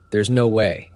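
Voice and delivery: ordinary delivery, nothing marked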